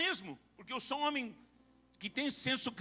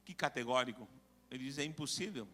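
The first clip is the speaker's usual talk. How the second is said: hushed solemn preaching; 5 semitones lower than usual. Real, not acted. Preacher weighing a grave verse for the church, low and hushed. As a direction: let the verse fall to a grave hush.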